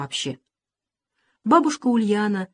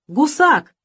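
loud high spat-out insult; angry insult; 8 semitones higher, 7 dB louder than usual. A narrator's reading. Angry boy calls his friend a coward, shout. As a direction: blurt the insult in anger. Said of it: "крикнул он".

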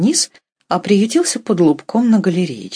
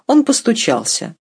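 ordinary delivery, nothing marked

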